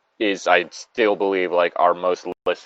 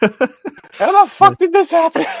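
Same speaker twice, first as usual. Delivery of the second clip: much higher, slow, through real laughter; laughing disbelief; 4 dB louder, slower than usual. Real, not acted. Player laughing over the absurd campaign turn, high and slow. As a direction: squeal it out laughing.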